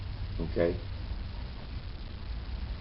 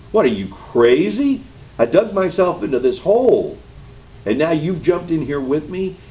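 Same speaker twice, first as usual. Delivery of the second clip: incredulous; 6 semitones higher, 4 dB louder than usual. Retold or imitated. Man reacts to someone jumping in.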